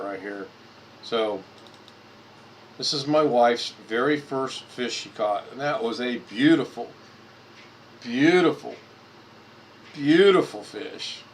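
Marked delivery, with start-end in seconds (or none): 6.2-11.2 admiring emphasis. Real, not acted. Admiring wife's first fish.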